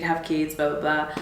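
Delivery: ordinary talk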